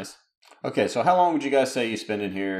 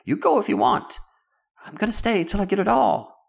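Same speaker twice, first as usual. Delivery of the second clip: obsessed digger; 12 semitones higher, 3 dB louder than usual. Acted. GM voices obsessed NPC.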